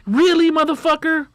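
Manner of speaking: incredulous scorn. Retold or imitated. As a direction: scoff at the cheapskate. Scorn at cheap weed smokers.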